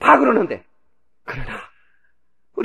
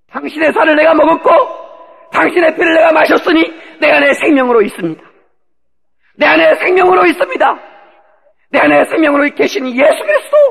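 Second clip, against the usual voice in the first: 높고 크게 외치는 대답; 주님께 답하듯 목청 높여 또박또박 선포; 6 semitones higher, 4 dB louder than usual. Acted. 성도가 주님께 드리는 힘찬 대답. (확신에 차서 크게 고백)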